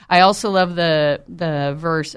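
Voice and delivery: normal talking voice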